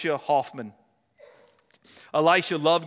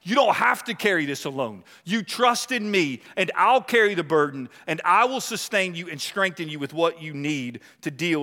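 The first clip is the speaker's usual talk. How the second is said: raised, driving pulpit voice; fervent preaching; 5 semitones higher, 4 dB louder than usual. Acted. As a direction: lift the congregation with conviction. Preaching, voicing the Lord.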